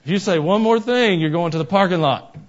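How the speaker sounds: loud, high mock threat; stern referee's warning. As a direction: bellow a warning at the mouthy coach. Referee threatens coach, acted louder.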